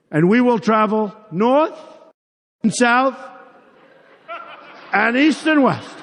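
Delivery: lightly imitated stiff teleprompter reading; mock Hillary Clinton. Candidate mocking a rival reading victory speech, mock stiff reading. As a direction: read it out in measured, mock-scripted chunks. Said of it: "Hillary Clinton, she's got a teleprompter".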